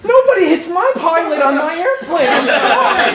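high, laughing mock outrage; mock indignation. Passenger defending the pilot on the plane, mock indignation. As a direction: declare it like a self-appointed hero. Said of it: "And I'm like".

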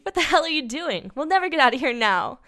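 high, loud exasperated outburst; acted furious complaint; angry scream. A narrator's reading. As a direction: yell it in frustrated panic. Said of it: "Mofo screamed".

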